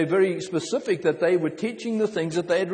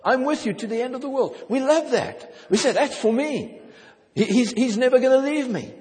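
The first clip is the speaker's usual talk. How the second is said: preaching with rising fervor; 8 semitones higher than usual. Real, not acted. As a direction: build the fire of the sermon.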